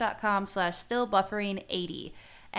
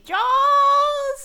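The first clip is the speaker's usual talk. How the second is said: high, loud, drawn-out shark scream; mock scream; 10 dB louder than usual. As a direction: scream it like a shark lunging to bite. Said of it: "He's screaming".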